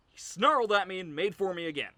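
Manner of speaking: high, loud, urgent telling; loud alarm. Acted. Telling how the dog made for him again, loud alarm. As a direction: ride the shock of the attack, loudly. Tells of the renewed attack.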